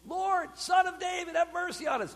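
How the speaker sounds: high, urgent plea; calling out. Retold or imitated. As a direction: imitate blind men starting a chant. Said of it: "these two blind men call out".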